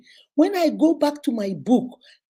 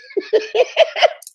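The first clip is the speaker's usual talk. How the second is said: loud, high burst of laughter; amused cackle; 10 semitones higher, 5 dB louder than usual. Real, not acted. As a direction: crack up at the gossip. Laughing after retelling gossip.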